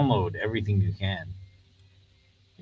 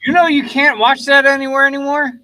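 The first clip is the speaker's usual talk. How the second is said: excited; 12 dB louder than usual. Real, not acted. Excited news to friend.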